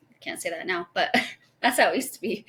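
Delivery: ordinary talk, nothing marked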